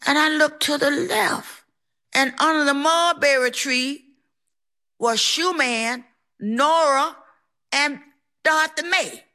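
usual speaking voice

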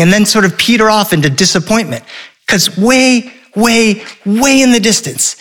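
plain reading voice

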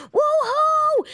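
high, whooping cheer from the window; cheering woo-hoo. Retold or imitated. Answering neighbors screaming below her window, a high joyful whoop. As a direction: cheer up at the street, thrilled. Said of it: "and I go".

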